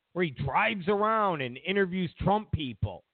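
ordinary talk, nothing marked

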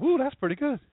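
high, impressed "Ooh, that's pretty good"; high, airy voice of an easily impressed admirer; admiring onlooker. Retold or imitated. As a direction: gush like an easily impressed layman. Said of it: "they look at it and go".

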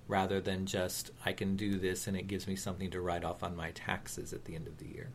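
ordinary talk

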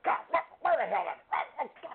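high, fast muffled grumbling creature; a lost Bigfoot muttering and yelping. Pretend. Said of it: "a muffled voice saying".

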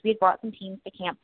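ordinary talk, nothing marked

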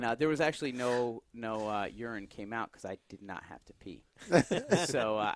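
ordinary talk, nothing marked

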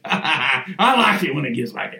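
amused chuckle while talking; laughing. Real, not acted. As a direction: laugh at his own fervor. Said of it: "Am I foaming at the mouth yet".

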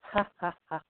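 breathy laugh from the stomach; laughing ahh. Shown as a demonstration. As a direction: laugh out from the belly. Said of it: "make the ah sound while you're laughing".

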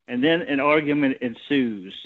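usual speaking voice

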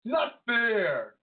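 high, petulant fan complaint; whiny internet fan. Acted. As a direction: gripe like an outraged online wrestling nerd. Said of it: "That's the I W C voice from now on".

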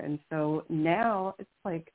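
ordinary talk, nothing marked

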